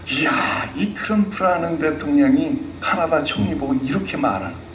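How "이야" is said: usual speaking voice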